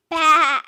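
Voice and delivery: loud bleating baa; sheep baa. A narrator's reading. Sheep calls out to the passing truck, loud bleat. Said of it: "Sheep said".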